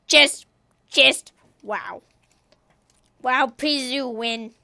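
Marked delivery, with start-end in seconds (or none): none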